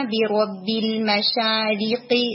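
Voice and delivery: slow demo of بِرَبِّ الْمَشَارِقِ with clear kasra; teacher models الْمَشَارِقِ with a clean kasra; tajweed demo. Shown as a demonstration. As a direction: read بِرَبِّ الْمَشَارِقِ slowly, every kasra distinct. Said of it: "جیسے یہاں پہ word تھا نا".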